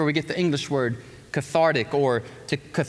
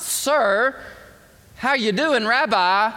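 casual greeting; 8 semitones higher, 4 dB louder, slower than usual. Acted.

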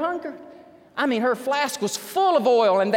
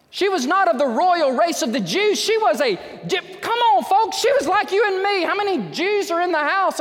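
louder and higher, calling out to the congregation; preaching at full force; 9 semitones higher, 5 dB louder than usual. Acted. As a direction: preach it out loud to the whole room. Preacher raising the energy.

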